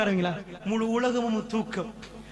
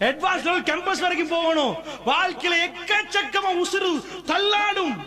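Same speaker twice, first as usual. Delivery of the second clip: fiery preaching; 7 semitones higher than usual. Acted. Preacher warning believers of trials, high climactic cry. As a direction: drive the warning home.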